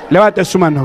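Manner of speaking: ordinary delivery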